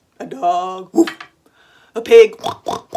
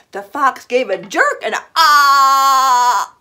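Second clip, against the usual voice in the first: sudden shout; 6 semitones higher, 9 dB louder, slower than usual. A narrator's reading. Fox snaps at cookie.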